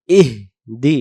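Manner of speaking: "ইহদি" as breathed ih, then di; broken into parts. Shown as a demonstration. Teacher breaking the word up, "ইহদি" as ih-di. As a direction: stop on a soundless h in "ইহদি", then দি. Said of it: "এখানে উচ্চারণ হবে".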